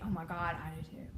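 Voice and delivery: low, fading aside; imitated confession. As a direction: drop low and trail away softly. Voices the reply never given.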